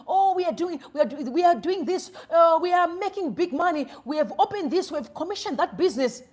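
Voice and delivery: loud, high mocking imitation; mock boastful screaming. Mimicking politicians boasting about big money, mock screaming. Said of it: "Where it's just screaming, lying".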